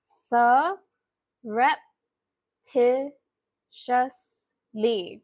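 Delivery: SURREPTITIOUSLY very slow, syllable by syllable, with gaps; said slowly. Shown as a demonstration. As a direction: break "Surreptitiously" into long separate syllables. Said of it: "let me say it slowly".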